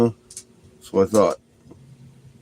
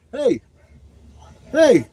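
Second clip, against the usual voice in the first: high-pitched call to dog; 5 dB louder than usual. Real, not acted. Calling the dog over.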